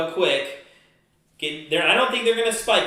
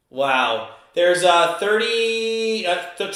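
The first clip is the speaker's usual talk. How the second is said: ordinary delivery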